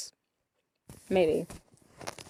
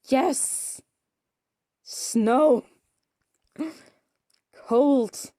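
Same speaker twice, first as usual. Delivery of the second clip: loud, higher one-word exclamations; esclamazione entusiasta; 5 semitones higher, 6 dB louder, much slower than usual. Real, not acted. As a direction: cheer each word out, loudly. Reacts to seeing snow.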